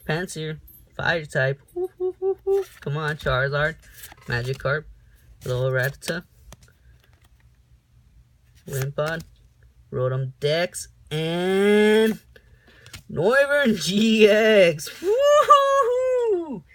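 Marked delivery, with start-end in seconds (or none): 1.8-2.6 high, loud eager oohs; excited ooh. Real, not acted. Vlogger oohing at a fire-type card. 11.1-16.6 slow, much higher, much louder yelling; ecstatic shout. Real, not acted. Vlogger yelling with joy at a rare card.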